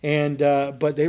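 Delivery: usual speaking voice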